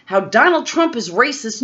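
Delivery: usual speaking voice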